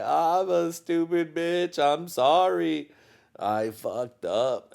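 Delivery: high, slow whiny apology; mock apology. Apologizing to his wife for the tantrum, slow whiny grovel. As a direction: grovel like a scolded kid. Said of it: "I was like".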